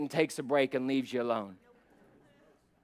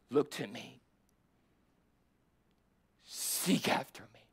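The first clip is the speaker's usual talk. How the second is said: hushed whisper. Acted. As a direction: drop to a whisper for God's words. God's quoted words drop to whisper.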